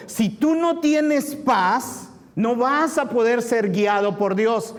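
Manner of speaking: high-pitched, urgent sermon delivery; fervent preaching. Real, not acted. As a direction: preach it insistently, driving each command home. Preaches at raised force.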